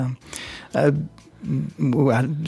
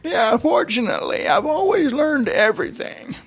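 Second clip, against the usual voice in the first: put-on old grandpa voice; 5 semitones higher than usual. Grandpa character.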